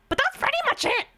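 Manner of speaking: very high squeaky little voice; Toad voice. Acted. Streamer trailing off in the squeaky Toad voice. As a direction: keep the tiny mushroom squeak going. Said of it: "I can do Toad pretty well".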